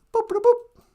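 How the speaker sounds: high, chirpy mouth-made boops; computer beeps. Pretend. Playing holodeck, imitating computer beeps. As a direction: beep like a sci-fi computer.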